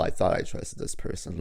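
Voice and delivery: ordinary talk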